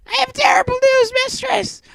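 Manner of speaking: extremely high squeaky robot voice; squeaky Becky. Acted. Becky bringing bad news to the Mistress, extremely high squeak. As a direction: squeak out the alarm. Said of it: "squeaked Becky".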